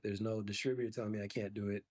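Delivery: ordinary talk, nothing marked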